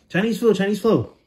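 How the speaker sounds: very high, loud mock chant; joking shout. Real, not acted. Storyteller shouts a silly joke about the Chinese fair. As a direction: yell it as a silly gag.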